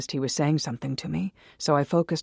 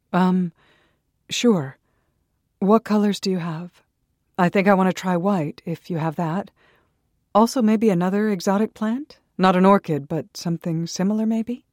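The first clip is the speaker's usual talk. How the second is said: louder, higher eager voice; 5 semitones higher, 7 dB louder than usual. Acted. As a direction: rush out the request hungrily. Eager customer ordering.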